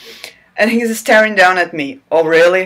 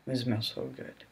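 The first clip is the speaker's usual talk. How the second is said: mock murmur; 6 semitones lower, 18 dB quieter than usual. Reader mocking the murmuring hero, murmured. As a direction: breathe it out barely audible, mocking the murmur. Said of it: "he murmurs a lot".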